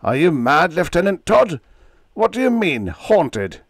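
loud, high disbelief; shouting. Acted. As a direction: yell it in disbelief.

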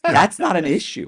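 loud, high-pitched disbelief; exasperated outburst. Retold or imitated. Exasperated reaction to ex-wife's complaint.

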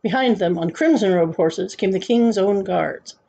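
ordinary delivery

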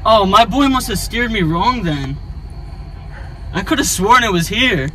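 ordinary talk

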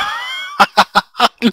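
loud disbelieving laugh burst; high shrieking laugh. Real, not acted. Laughs at burning sheep. (risa aguda y fuerte)

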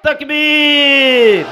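high, drawn-out rallying shout; slogan shouting. Real, not acted. Preacher rouses the crowd with takbir, shouted slogan. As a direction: belt out the slogan, stretching the vowels.

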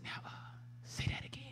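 whispered, deliberate imitation of the pastor; hushed, measured challenge down the phone; quiet pastor. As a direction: ask very softly, with a pause. Pastor back on silent phone.

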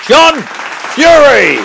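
high, loud drawn-out name call; announcer's shout. Acted. Host sends off his favourite guest, big announcer's shout.

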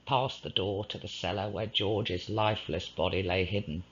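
ordinary delivery, nothing marked

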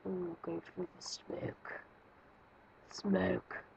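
slow, hushed whisper; spooky whisper. Pretend. Player creeping through smoke in game, spooky whisper.

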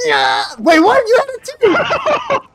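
high excited yell breaking into laughter; scream into giggles. Real, not acted. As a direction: shriek it out, then crack up laughing.

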